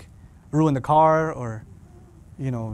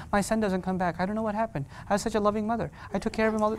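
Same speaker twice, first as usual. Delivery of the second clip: softer, mock-sorrowful mother; lamenting mother; 3 semitones higher, 6 dB quieter, faster than usual. Mother complaining to the imam, softer. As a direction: plead like a wronged mom. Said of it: "Then you'll come to me".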